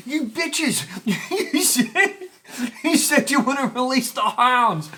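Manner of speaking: high, loud outraged cry, slow at the end; boy fleeing the dog, yelling at the girls who let it out. Retold or imitated. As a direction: hurl betrayed fury while running. Angry at girls while fleeing.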